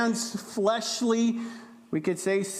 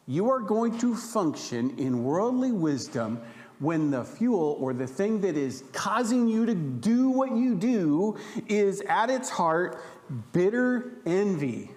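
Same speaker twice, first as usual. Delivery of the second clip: ordinary talk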